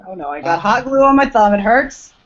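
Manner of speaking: normal talking voice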